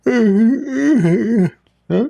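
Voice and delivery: high, indignant put-on grunt; mock upset god. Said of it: "Oh, Allah is upset now".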